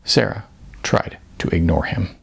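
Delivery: ordinary delivery, nothing marked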